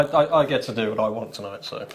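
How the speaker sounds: normal talking voice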